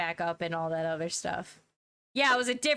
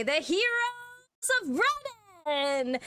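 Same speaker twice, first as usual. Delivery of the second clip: much higher, slow, ringing toast; toast shout; 12 semitones higher, much slower than usual. Acted. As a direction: raise a glass and hail them to the room. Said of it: "she's gonna say".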